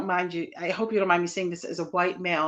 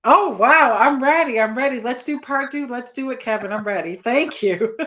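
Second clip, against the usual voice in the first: loud, high-pitched eager burst; excited; 7 semitones higher, 10 dB louder than usual. Real, not acted. Guest accepting host's invitation for part two, excited. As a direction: jump at the offer with real excitement.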